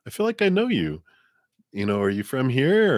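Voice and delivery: high, quick flirty stranger voice; pickup line. Retold or imitated. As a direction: play the smitten stranger. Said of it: "sounds like a pickup line".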